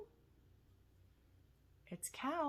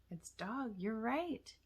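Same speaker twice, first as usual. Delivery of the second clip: ordinary delivery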